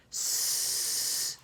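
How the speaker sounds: long hissed S for the class; stretched S. Shown as a demonstration. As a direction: hiss the S slowly so kids copy. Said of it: "Make it with me".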